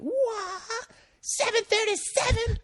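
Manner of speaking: very high, strained falsetto yell; squealed mock battle scream; mock karate scream. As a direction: imitate a karate war cry without full volume. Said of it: "as loud as he could".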